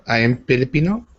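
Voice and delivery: ordinary talk, nothing marked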